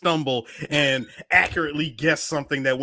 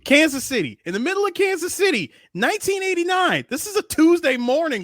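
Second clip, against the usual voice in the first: much higher, rushed, insisting; excited insistence; 12 semitones higher than usual. Real, not acted. Arguing with friends, high excited insistence. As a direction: pitch up high to win the argument.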